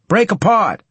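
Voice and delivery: high, loud battle command; shouted order. Acted. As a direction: yell the order to scatter. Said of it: "I ordered".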